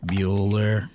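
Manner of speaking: low, droning roll-call voice; Ben Stein monotone. Retold or imitated. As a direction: call the name like a bored teacher. Ferris Bueller roll-call impression.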